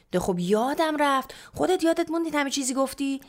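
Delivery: high, loud protest at mother; defensive retort. A narrator's reading. Daughter argues back at yelling mother. (جواب معترضانه با صدای بالا)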